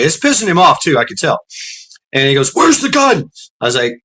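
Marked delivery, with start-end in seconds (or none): 2.5-3.3 high sharp demand; demanding cop. Retold or imitated. Trooper demanding the gun, high and sharp.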